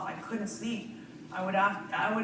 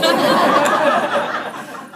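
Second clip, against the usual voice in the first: loud laugh; 8 semitones higher, 15 dB louder than usual. Real, not acted. Laughs at audience reply.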